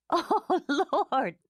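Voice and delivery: high giddy laughing exclamation. A narrator's reading. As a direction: gasp it through laughter. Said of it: "she said again".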